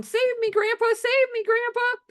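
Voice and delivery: put-on a kid shrieking to grandpa as grandma chases; mock panicked cry. Little girl fleeing grandma, squealing for grandpa to save her.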